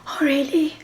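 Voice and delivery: high, surprised crew reaction; acted surprise. Acting out the crew's surprised reaction.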